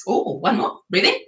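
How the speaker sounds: ordinary talk, nothing marked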